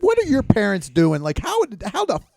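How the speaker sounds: high, loud disbelief; incredulous. Real, not acted. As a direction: pitch up in amazement. Amazed rant about [name].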